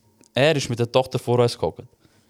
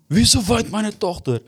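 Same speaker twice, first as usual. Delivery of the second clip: mock angry father; 7 semitones higher, slower than usual. Father demands, retold.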